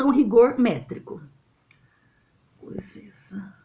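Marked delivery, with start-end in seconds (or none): 2.6-3.5 very soft, voiceless excuse; hushed little interjection; whispered aside. Real, not acted. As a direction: whisper it under your breath. Aside excusing herself mid-lesson.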